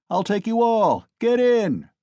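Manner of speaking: loud, high bragging bellow; swaggering shout; drunken shout. A narrator's reading. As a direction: roar the offer to the crowd. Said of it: "Mikolka shouted again".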